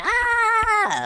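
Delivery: long high-pitched mock scream; imitated scream. Joker acting out the choked homie, mock screaming. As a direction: hold a high "ah" at ordinary volume. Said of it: "you start screaming".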